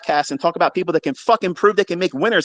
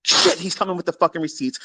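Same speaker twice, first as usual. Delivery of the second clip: normal talking voice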